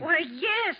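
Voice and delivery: surprised. Acted. Landlady suddenly recognizes the town.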